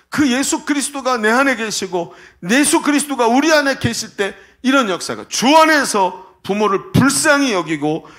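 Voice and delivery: emphatic, high-energy sermon voice; impassioned preaching. Acted. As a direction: drive each phrase home to the congregation. Preaches at full force.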